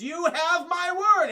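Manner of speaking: loud, high, slow hammed-up vow; grand villain. Mysterio promising the crowd, slow loud hammed-up vow.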